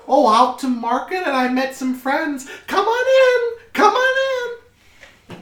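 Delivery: high, loud, welcoming mother voice; cheery mother. Acted. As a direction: call the guests in, high and loud.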